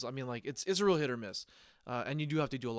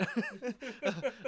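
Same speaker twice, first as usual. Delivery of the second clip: guffaw; 9 semitones higher than usual. Real, not acted. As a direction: burst out in a big guffaw. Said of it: "aside from me guffawing".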